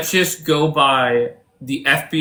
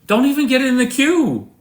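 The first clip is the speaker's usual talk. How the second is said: dismissive; 10 semitones higher than usual. Real, not acted. Host barring callers.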